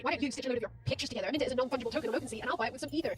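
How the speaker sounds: rapid-fire crypto pitch; fast sales pitch. Pretend. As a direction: rattle off the pitch very fast like a hyped crypto bro. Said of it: "and you say".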